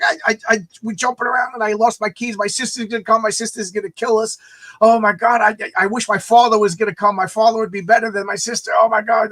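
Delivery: high, run-on mock panic; panicked rambling. Jimmy frets to Lemmy about his sister, panicked rambling. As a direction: babble anxiously without letting up.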